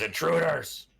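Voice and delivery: high, loud sentry's yell; yelling in alarm. Acted. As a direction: shout the alarm down the hall. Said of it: "and yells in Draconic".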